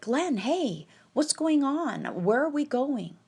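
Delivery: half-voiced yell over to the realtor; retold calling out. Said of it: "I yell over".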